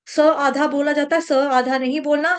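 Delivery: usual speaking voice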